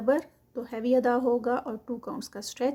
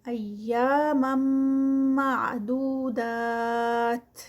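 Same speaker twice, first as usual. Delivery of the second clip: slow, drawn-out tajweed recitation; Quranic recitation; 3 dB louder, much slower than usual. Shown as a demonstration. Teacher reciting the verse for students, slow and melodic. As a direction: chant each word slowly, stretching the vowels.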